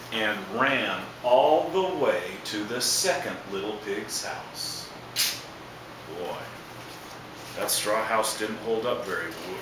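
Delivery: ordinary delivery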